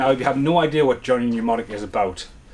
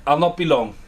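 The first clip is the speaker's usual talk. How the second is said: raised voice to another room; calling out; 4 semitones higher, 5 dB louder than usual. Real, not acted. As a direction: call back loudly to someone away. Calling to someone off camera.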